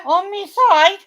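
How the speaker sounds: high-pitched incredulous echo; real affronted query; indignant disbelief. Real, not acted. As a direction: throw it back in disbelief, pitch leaping. Indignant echo of sister's account.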